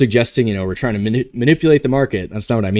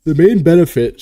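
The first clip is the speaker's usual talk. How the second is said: louder words stretched by a real yawn; talking through a yawn; 5 semitones higher, 5 dB louder than usual. Real, not acted. Host yawning while explaining to stream viewers, yawning. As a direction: let a yawn catch the words mid-phrase. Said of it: "sorry, I just keep yawning".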